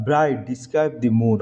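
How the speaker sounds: very slow, careful reading; slow explanation. Shown as a demonstration. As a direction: explain it slowly for the class. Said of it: "explains slowly". (ধীরে ব্যাখ্যা)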